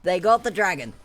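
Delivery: excited. Real, not acted. Co-caster echoes dragon steal.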